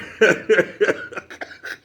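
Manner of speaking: breathy high laughter; wheezing laugh. Real, not acted. Laughing at his own joke about the cop, breathy and high.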